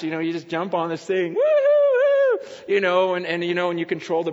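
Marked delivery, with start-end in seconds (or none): 1.3-2.5 high, loud, drawn-out woo-hoo; joyride whoop. Retold or imitated. Dad jumping on the sled ride, gleeful high whoop. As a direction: whoop like you're flying downhill.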